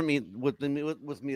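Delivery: stumbling, trailing off into a mumble; real tongue-tied fumble. Real, not acted. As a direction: trip over the words. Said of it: "I'm getting tongue-tied".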